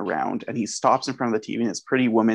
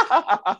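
mock yelp of pain. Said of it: "You're like".

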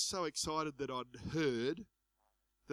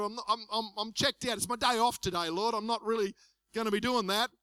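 higher, louder protesting excuse; mock protest; 5 semitones higher, 4 dB louder than usual. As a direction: plead your day off to God, playfully. Acted excuse to God on holiday.

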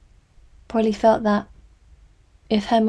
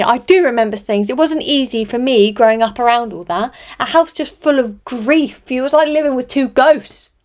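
loud, high anguished shouting; angry shout; 4 semitones higher, 8 dB louder than usual. A narrator's reading. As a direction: shout it out of old pain. Julia continues shouting.

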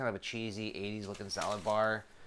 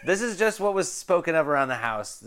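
laughing, higher and louder protest; laughing defensively; 5 semitones higher, 8 dB louder than usual. Real, not acted. Defending a childhood story to a teasing co-host, laughing defensively.

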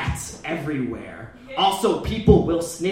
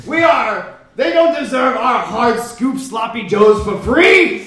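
shouting; 4 semitones higher, 6 dB louder than usual. Acted. Outraged rant at kids.

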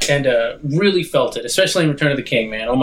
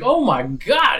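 high, awed exclamation; delighted actor; 7 semitones higher, slower than usual. Retold or imitated. Actor amazed by his own scene, delighted. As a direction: imitate an actor overjoyed. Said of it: "he was happy about it".

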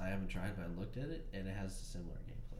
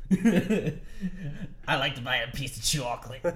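put-on old lady voice; 4 semitones higher, 8 dB louder than usual. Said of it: "sounded like an old lady".

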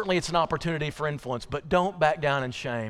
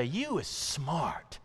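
slow, drawn-out nanny voice; softly, slowly reassure a little girl; nanny affirming a child; 4 dB quieter, much slower than usual. Retold or imitated. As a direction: say each line slowly, with long weight on YOU. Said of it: "this lady would look at her and say".

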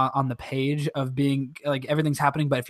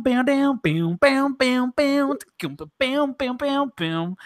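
mock dramatic beat; 9 semitones higher, much slower than usual. Imitating dramatic school entrance.

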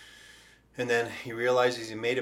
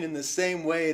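normal talking voice

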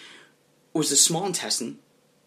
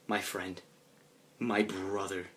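low, soft, heartfelt address; hushed mock-solemn affection; brotherly tenderness; 4 semitones lower, 7 dB quieter, slower than usual. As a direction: say it low and intimate, pausing between phrases. Acted solemn brotherly embrace.

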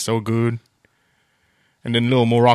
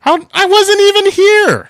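indignant yell; 9 dB louder than usual. Acted. Denying letting him back, high indignant yell. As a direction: shout your innocence, stretching the words. Mock quarrel over who let guest in.

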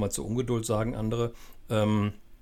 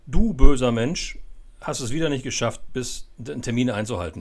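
exaggerated accusation that settles down; mock reproach; 8 dB louder than usual. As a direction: act out blaming someone, hammed-up at the start. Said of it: "mit Schuld und Vorwürfen".

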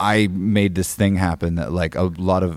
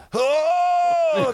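cheering shout. Retold or imitated. Russians cheering after his song.

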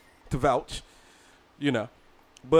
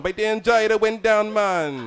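louder, higher mock Jamaican accent, stretched out; 6 semitones higher, 4 dB louder, much slower than usual. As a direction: switch into an exaggerated Jamaican accent. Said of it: "As soon as he got on".

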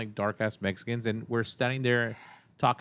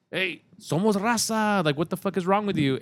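confronting shout; 9 semitones higher, 5 dB louder than usual. Retold or imitated. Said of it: "and he goes".

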